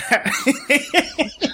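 slow, high, laughing through it. Real, not acted. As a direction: giggle out the words. Joke breaks into laughter.

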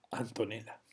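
soft, low seductive voice. Shown as a demonstration. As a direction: breathe the name seductively. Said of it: "tengo una voz seductora".

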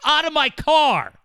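high, loud yelled order, rising to a bark on Out; angry yell. Retold or imitated. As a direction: throw them out of the car. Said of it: "I said".

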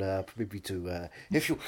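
ordinary talk, nothing marked

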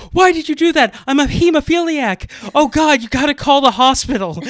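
loud, high, hammed-up panic; fake panic. Tricking the bully who punched him, loud hammed-up fake panic. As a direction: shout in mock alarm like you're bleeding out. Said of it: "I would go".

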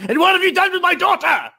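high, theatrical demand; hammed-up indignant yell; accusing shout. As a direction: shout it as an outraged parent. Character confronts soldiers about daughter.

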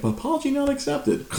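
high, prim refusal; high prim snub to the coworker; haughty dismissal. Retold or imitated. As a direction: snub him, sing-song high.

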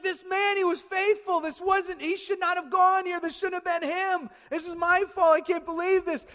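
high, loud, acted panic; freaking out. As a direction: play a distraught king, frantic and loud. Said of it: "the king is absolutely freaking out".

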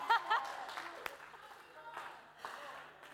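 airy unvoiced laugh trailing off; breathy laugh. Real, not acted. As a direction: laugh it out under your breath. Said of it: "It was real bad".

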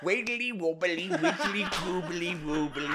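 lightly voiced daft creature going down a well, giggling; sing-song the wiggly wobbly like a goofy little critter; silly character voice. To Eli, his daft character heads down the well in a silly giggly voice. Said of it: "my character".

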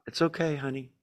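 put-on soft, tender comforting of a hurt child; hushed motherly reassurance; soothing mother. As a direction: comfort a little one gently and quietly. Mom soothing a crying child.

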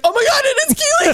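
high, loud, rushed gasp of recognition; mock astonishment. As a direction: act out a shocked, squealing realization. Acted shock at recognizing her.

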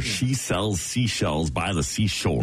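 slow, deliberate tongue twister; slow practice take; slow careful repeat. Shown as a demonstration. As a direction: say each word slowly and carefully. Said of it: "you repeat it".

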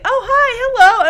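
high bright hello; chirpy greeting. Retold or imitated. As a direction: greet them sweetly.

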